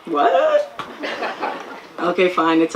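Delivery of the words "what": high, puzzled mock reaction with a laugh; squeaky high bewildered "what"; baffled "what". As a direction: play the confused foreigner. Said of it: "people are like".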